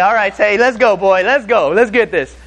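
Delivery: lightly acted gung-ho interviewer; pep talk. Hyping up a new interviewee.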